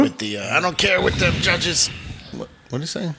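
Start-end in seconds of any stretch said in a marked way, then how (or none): 0.6-1.9 gruff-trainer quote, pitched way up; Mickey. Acted. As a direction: act out the trainer's outburst in a raised pitch. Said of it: "Mickey throws fuel on the fire".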